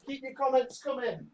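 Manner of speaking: receding from the microphone; calling while walking off. Real, not acted. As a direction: call back as you head for the door. Leaving mic to get door.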